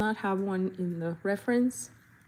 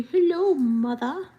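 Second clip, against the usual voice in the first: high, louder British hello mother; mock British dog voice; 6 semitones higher, 6 dB louder, slower than usual. Voicing her dog greeting her, mock British. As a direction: give the dog a posh British voice. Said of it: "that's the voice she gets today".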